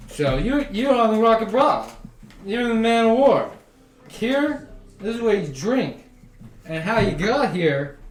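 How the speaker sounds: high, loud, chummy bartender voice; boastful barkeep. Acted. Bartender bragging to travelers, high and loud. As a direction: puff up like you just won a battle.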